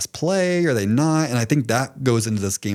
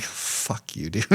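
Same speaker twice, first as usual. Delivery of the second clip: whispered retort; 5 semitones lower, 10 dB quieter, much slower than usual. Retold or imitated. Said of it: "I'm like".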